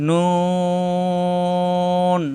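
"নুন" held very long on one note; four-alif stretch. Shown as a demonstration. Teacher demonstrates four-alif length, very long stretch. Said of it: "চারালি পরিমাণ টান হবে".

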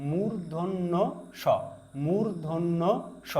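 মূর্ধন্য drawn out as mur-dhon-no, pause, then স; teacher's syllable-by-syllable মূর্ধন্য স; careful demonstration. Shown as a demonstration. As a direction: split মূর্ধন্য from a separate স. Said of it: "উচ্চারণটা দেখি আমরা".

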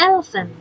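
elephant said slowly and clearly; teacher modelling elephant; model word. Shown as a demonstration. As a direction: read elephant out for the class. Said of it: "คำต่อมาค่ะ".

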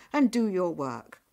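loud, stern order; cross mother. A narrator's reading. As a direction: send her back to her chores. Mother silences protesting daughter.

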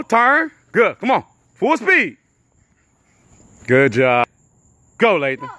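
loud coaching shouts across the field; coach yelling. Real, not acted. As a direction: bark the drill commands at full volume.

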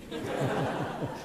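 soft laugh. Real, not acted. Laughs at his own story.

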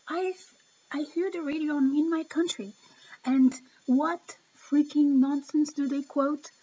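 usual speaking voice

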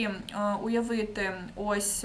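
ordinary delivery

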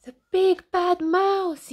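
high, loud, slow scream; terrified yell. Acted. Gruffalo's child screaming at the giant shadow. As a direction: yell it out in terror. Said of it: "yelled the Gruffalo's child".

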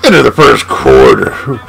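usual speaking voice

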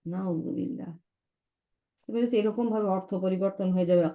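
ordinary talk

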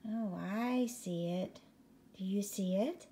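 usual speaking voice

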